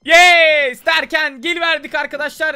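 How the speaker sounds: loud triumphant yell, pitch up; excited shout. Real, not acted. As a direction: shout it out like you just beat the level. Bug breakthrough, shouting to viewers.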